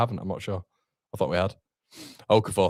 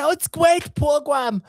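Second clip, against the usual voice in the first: much higher, slow put-on manager voice; Daniel Farke impression; 4 dB louder, slower than usual. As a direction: present the show as the boss in a high mock voice. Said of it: "Petition to have [name] present ... in the Daniel Farke impression".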